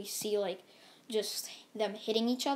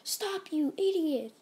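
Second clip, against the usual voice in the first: higher, slow, angry yell; 7 semitones higher, slower than usual. Retold or imitated. Deku stops the heroes attacking Frieza, angry yell. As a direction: yell to stop them cold. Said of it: "Deku yells at him".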